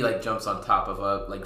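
ordinary delivery

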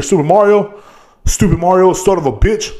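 ordinary talk, nothing marked

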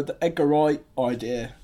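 ordinary delivery

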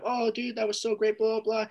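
high, gushing fan voice. Retold or imitated. Comic imitating audience members praising him after the show. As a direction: play the eager fan heaping praise.

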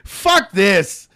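loud, high retold outburst; angry outburst. Retold angry refusal to doctor.

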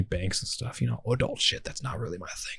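whispered aside; a quick whispered confession. Real, not acted. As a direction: drop to a whisper.

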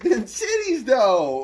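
high, loud, laughing exclamation; hammed-up excitement. Echoing a friend's crude joke, laughing mock excitement. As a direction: whoop it through laughter.

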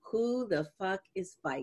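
subdued retold quote. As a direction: repeat the outraged line quietly, as a punchline. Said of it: "I will never forget".

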